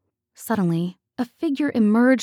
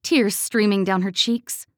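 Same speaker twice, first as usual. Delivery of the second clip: ordinary delivery, nothing marked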